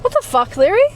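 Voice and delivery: high, loud shocked outburst. Retold or imitated. Shocked at Zach's follow request, loud and high. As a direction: blurt it out in disbelief.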